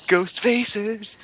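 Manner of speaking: slow eerie ghostly wail; drawn-out spooky theme call; unearthly voice. Pretend. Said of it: "like the Ghost Facers theme song".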